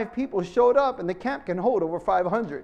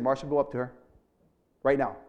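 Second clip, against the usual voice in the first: lower, calm commanding inner voice; voice of the Holy Spirit; 7 semitones lower, 3 dB quieter, much faster than usual. Retold or imitated. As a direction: speak as a low, firm voice from within. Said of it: "the Holy Spirit said".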